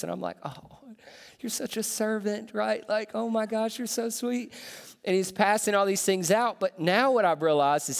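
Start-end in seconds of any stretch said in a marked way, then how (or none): 0.4-2.5 cooing praise. Retold or imitated. Dad praising son's sweetness. 3.1-4.5 cooing praise. Retold or imitated. Dad praising son's sweetness.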